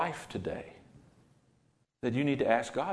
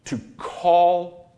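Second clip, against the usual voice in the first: higher and louder, a sudden cry; call out; 5 semitones higher, 7 dB louder than usual. Shown as a demonstration. Preacher explains the word, calling it out loud. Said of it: "That word means".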